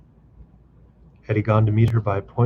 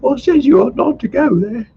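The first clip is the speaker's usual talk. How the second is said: high, louder reproachful cry; mother cries out; 7 dB louder than usual. A narrator's reading. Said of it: "cried Varvara Alexeyevna".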